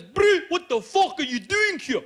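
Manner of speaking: high, loud, rapid South African; South African local. Retold or imitated. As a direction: yell a frantic warning in a thick South African accent. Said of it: "This guy goes".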